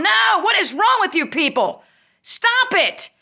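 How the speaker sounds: high, exasperated yelling; exasperated outburst. Real, not acted. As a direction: scold them with your pitch shooting up. Ranting at dog rescuers.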